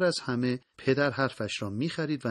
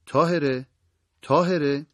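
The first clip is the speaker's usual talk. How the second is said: a restrained, louder call of a name; 3 semitones higher, 6 dB louder than usual. A narrator's reading. Father calling his daughter into the room, mild shout. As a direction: call out firmly, slightly raised. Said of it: "داد زد".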